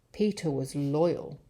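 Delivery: usual speaking voice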